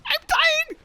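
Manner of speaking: high, squeaky comic wail; silly dying voice. Shown as a demonstration. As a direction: play dying as a joke. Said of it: "'Cause it's a silly voice".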